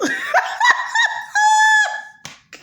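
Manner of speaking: a long, high, loud laugh; loud shrieking laugh. Real, not acted. Vlogger cracks up at her Dorothy joke, loud shrieking laugh.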